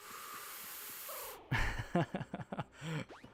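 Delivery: long, breathy laugh; soft laugh that runs on breath. Real, not acted. Player amused by a find, breathy laugh. Amused by what just happened.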